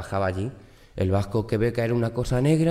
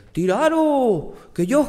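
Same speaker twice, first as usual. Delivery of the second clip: bold shout; 4 dB louder than usual. Acted. The Basque man shouting up to the people in the fire. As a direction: bellow it like a strongman ready to catch. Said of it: "Y el vasco dice".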